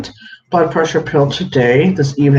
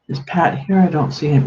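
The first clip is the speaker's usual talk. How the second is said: normal talking voice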